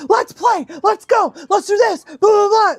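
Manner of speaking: high, excited rapid-fire bursts; hyper playful cat. Acted. Cat wound up on catnip, high hyper chatter. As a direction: bounce off the walls, begging to play. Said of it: "one cat might get playful".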